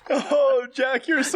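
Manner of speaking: high, gushing sarcastic praise; mock gushing praise. Friend mock gushing over a joke, high and sarcastic. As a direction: gush over him in a squeaky mock voice.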